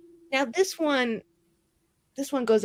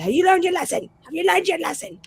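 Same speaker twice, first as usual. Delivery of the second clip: high, harsh taunting guard; cruel Templar; 10 semitones higher, faster than usual. Acted. Templar taunting the captive mage, higher and mocking. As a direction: sneer it down at a broken prisoner. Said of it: "a Templar who ... is like".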